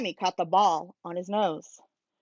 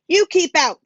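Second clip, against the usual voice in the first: louder, higher sharp warning; a policeman ordering him off; stern policeman; 8 semitones higher, 6 dB louder than usual. A narrator's reading. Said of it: "said the policeman".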